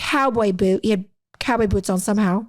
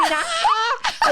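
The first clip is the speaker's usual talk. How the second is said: mock scream. Roach victim facing police officer, mock high scream. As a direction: re-enact a high panicked shriek. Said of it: "And I'm, like, screaming".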